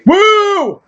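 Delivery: high excited woo; excited cheer. Real, not acted. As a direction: cheer with delight. Cheering at ice cream.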